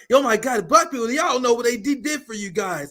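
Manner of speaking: high-pitched parody of exasperated liberals; mock indignant liberals. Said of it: "They're like".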